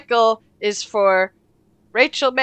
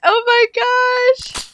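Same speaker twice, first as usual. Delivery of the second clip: shocked; 11 semitones higher than usual. Real, not acted. Reacting to zero hours left.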